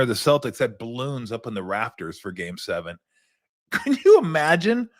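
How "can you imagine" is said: higher, laughing in disbelief. Real, not acted. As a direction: laugh it out, amazed. Laughing at the balloons story.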